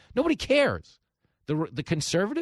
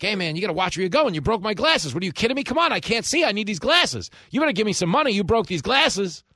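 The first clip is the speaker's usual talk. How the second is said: higher, loud and indignant con man; street hustler; 8 semitones higher, 6 dB louder than usual. Retold or imitated. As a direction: play a loud hustler faking outrage. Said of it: "be like".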